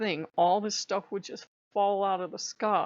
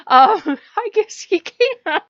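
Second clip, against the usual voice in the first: high, loud, laughing through the words; nervous laughing; 7 semitones higher, 10 dB louder than usual. Real, not acted. Caught out on Norse history, laughing high and loud.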